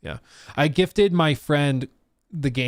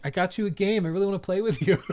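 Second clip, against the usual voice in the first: fake sweet; 7 semitones higher than usual. Pretending to gift a prank game.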